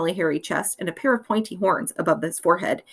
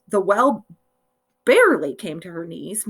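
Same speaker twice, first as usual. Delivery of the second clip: ordinary delivery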